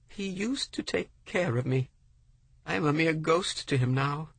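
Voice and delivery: high, sorrowful woman's voice; ashamed widow. A narrator's reading. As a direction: admit it with shame, pitched high.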